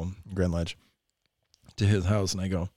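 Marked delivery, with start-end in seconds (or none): none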